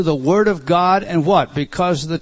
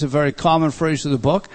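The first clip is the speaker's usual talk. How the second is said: usual speaking voice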